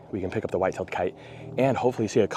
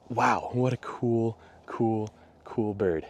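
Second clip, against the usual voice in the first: awed; 2 semitones higher, much slower than usual. Real, not acted. Admiring the kite.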